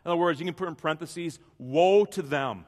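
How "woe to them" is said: slow, weighty "Woe to them" with every word leaned on; emphatic. Shown as a demonstration. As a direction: drag out "Woe to them" and make it land hard. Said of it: "This is emphatic".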